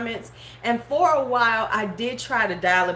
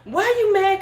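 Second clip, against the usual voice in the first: higher, louder mock disbelief; louder amused why are you mad; incredulous question; 10 semitones higher, 5 dB louder than usual. Real, not acted. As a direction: toss it back, amused. Mocking angry trolls.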